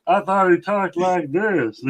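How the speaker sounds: high-pitched mocking impression; Ralph. Friend mimicking how Ralph talks, high-pitched impression. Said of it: "That was their impression".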